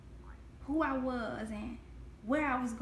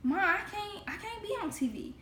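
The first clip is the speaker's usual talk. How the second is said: put-on a touch of a tearful little girl, pitched higher; imitating crying child; 8 semitones higher than usual. Scene: little girl running to mom, mildly tearful and higher. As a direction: replay her younger self's whimper, lightly. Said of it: "cry and be like".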